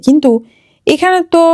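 ordinary talk, nothing marked

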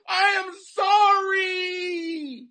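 high, loud, very slow wailing sorry; fake sobbing apology. Comic choosing the millions, very slow wailing apology. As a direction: drag it out as a hammed-up sob. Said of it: "It's millions of dollars".

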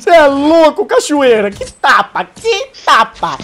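hyped. Real, not acted. Hyped after teammate's kills.

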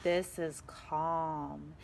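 ordinary delivery, nothing marked

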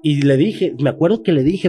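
normal talking voice

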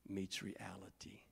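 slow soft whisper; dramatic whisper. Real, not acted. Preacher hushing the climax for the congregation. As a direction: hush it for weight. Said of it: "dramatic hush".

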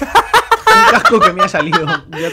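high, laughing brag; laughing boast. Real, not acted. Friend showing off new helmet, laughing boast. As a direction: show off through giggles.

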